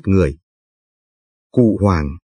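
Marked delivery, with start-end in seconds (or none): none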